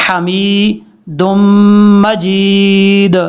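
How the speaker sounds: इब्राहीमा held long, हमीदुम with light ह, slow; lengthened reading. Shown as a demonstration. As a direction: draw out the long vowels. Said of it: "वहाँ पे भी लंबा करना है।". (लंबे ज़बर के साथ धीरे पढ़ना)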